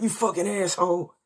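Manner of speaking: high squealing insult; mock outrage. Friend cursing him for not tipping, high mock squeal.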